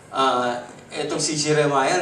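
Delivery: normal talking voice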